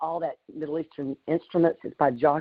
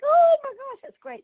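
excited squeal; 4 dB louder than usual. Retold or imitated. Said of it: "And I was like".

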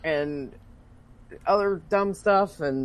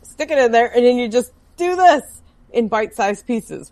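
exasperated instruction; 6 semitones higher, 6 dB louder than usual. Retold or imitated. Acting out steak-cutting lesson for friend.

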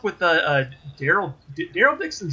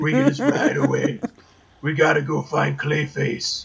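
mock Batman-style gruff voice; exaggerated husky impression, slowing at the end; Daryl Dixon; 3 semitones lower than usual. Survivor planning to ride away, hammed-up Batman voice. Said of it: "that's what he sounds like".

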